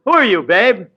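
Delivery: usual speaking voice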